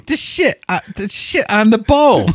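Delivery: high put-on voice, laughing through it; squeaky mock killer voice, cracking up; Ronald DeFeo impression. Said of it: "[name] doing Ronald DeFeo".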